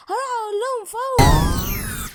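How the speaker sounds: high, loud eager announcement; excited. Acted. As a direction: rush off bursting to tell. Hurries off to tell witches.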